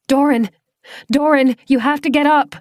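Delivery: high, loud desperate plea; urgent shout. Acted. Claire shaking half-frozen Doran on the shore, loud high shout. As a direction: yell at him to get him moving.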